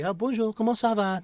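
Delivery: high, bright sing-song bonjour; cheery French greeting. Retold or imitated. Greeting an old Haitian neighbor on the street, high and cheery. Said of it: "And I say".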